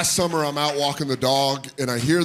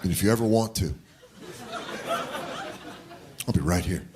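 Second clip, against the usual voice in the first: soft, lower earnest comfort; tender dad; 4 semitones lower, 7 dB quieter, faster than usual. Retold or imitated. Dad gently comforting a fatherless kid, soft and low. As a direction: play the caring father gently.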